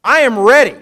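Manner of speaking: high, loud, fervent pledge of loyalty; voicing Peter's bold vow. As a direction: play Peter swearing he'll follow anywhere. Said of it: "Lord, I am ready to go".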